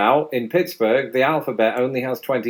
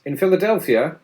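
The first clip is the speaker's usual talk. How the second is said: ordinary talk, nothing marked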